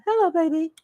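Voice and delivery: high, sweet pet voice. Real, not acted. Coaxing her crying cat, high and sweet. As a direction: coo at the cat.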